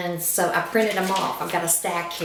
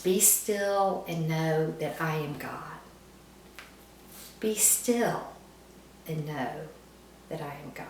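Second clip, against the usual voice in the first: hushed, calming scripture, trailing softer; 2 semitones lower, 6 dB quieter than usual. Shown as a demonstration. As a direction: let each phrase settle, sinking quieter. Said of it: "pray for God to bring me those words".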